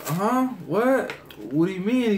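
high-pitched playing dumb; feigned innocence. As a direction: act clueless when caught out. Playing innocent to suspicious dad.

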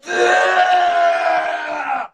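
long drawn-out yell; cheering yell. Real, not acted. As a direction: whoop it up loud. Cheering during the dance battle.

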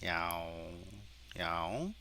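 soft, low meows; cat meow imitation. Shown as a demonstration. Teacher testing the cat detection, softly imitated meowing. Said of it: "고양이 한번 해볼까요".

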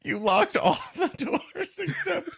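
high, laughing incredulous squeal; laughing disbelief. Real, not acted. Co-host cracking up at the missing doors. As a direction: laugh through the outrage at the plot.

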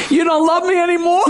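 hammed-up crying voice; mock weeping. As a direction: whine it tearfully as the crying girlfriend. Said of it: "she's weeping. She's crying".

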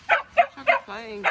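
high, slow sing-song dog talk. Real, not acted. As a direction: coo at the puppies like babies. Talking to dogs, repeated.

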